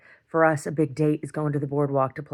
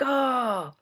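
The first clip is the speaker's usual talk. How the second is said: caught out gasp; 8 semitones higher than usual. Retold or imitated. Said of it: "she's like".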